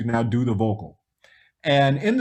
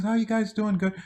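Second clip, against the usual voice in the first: soft-spoken Michael; 7 semitones higher than usual. Retold or imitated. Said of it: "he's very quiet".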